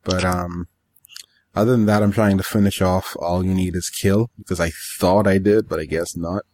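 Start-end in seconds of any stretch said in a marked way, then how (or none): none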